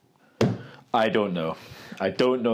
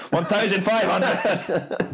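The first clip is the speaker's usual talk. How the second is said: slow, high robotic count; automated rep counter; 9 semitones higher, much slower than usual. Retold or imitated. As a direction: call the reps out like a gym machine. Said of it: "it would just go from".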